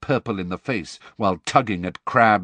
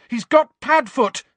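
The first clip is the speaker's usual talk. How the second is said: much higher, louder desperate shout; 7 dB louder than usual. A narrator's reading. Harry shouts a coded warning to Snape. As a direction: yell it after him. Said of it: "he shouted".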